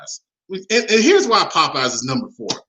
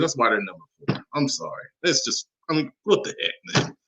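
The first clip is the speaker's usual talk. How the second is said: ordinary talk, nothing marked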